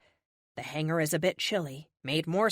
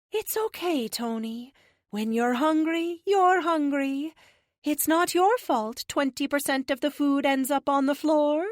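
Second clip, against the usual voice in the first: sweetly reassuring alien; 8 semitones higher, 5 dB louder than usual. Acted. Alien comforting a messy teammate, high and reassuring. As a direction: console him in a much higher voice, slowing on the key phrase. Lux reassures Tony in figure voice.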